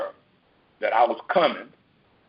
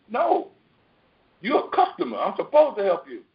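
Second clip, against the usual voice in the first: insistent store clerk; 6 semitones higher than usual. Retold or imitated. Acted insistent African store clerk.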